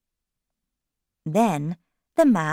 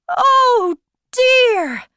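high, loud, drawn-out lament; dismayed; 9 dB louder than usual. A narrator's reading. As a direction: wail it slowly as a storybook Hare. March Hare laments the wet watch.

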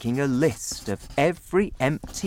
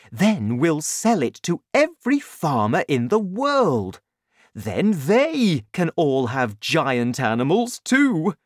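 loud, higher, swelling enthusiasm; excited father; 6 semitones higher, 5 dB louder than usual. A narrator's reading. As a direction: pitch your grand scheme, building to a high finish. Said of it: "Mister Cranky cried".

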